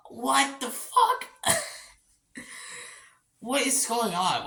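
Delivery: high, slow, drawn-out disbelief; can't believe what's on screen; stunned outburst. Retold or imitated. Reviewer recalls the friendly animatronics, high slow disbelief. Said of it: "I'm like".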